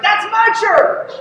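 high-pitched excited exclamation, drawn out; loud and excited. Retold or imitated. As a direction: blurt it out with delighted recognition. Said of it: "he said loudly and with excitement".

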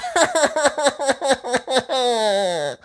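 put-on a bright, high child's giggle. Peppa giggling at little Daddy's voice, high. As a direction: giggle at the squeaky voice. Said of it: "says Peppa, laughing".

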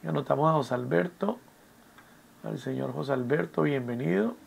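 soft, absent voice while noting a name; talking while writing. Real, not acted. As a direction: say it quietly to yourself as you write. Noting down a late student's name.

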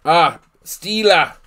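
slow mock Boston accent on "Steeler"; lightly voiced Boston accent. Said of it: "do you actually have the Boston accent?".

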